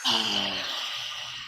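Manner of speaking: a deep, low sigh of release; long exhale sigh. Shown as a demonstration. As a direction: let all the air out with a long sigh. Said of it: "breathe 'em out".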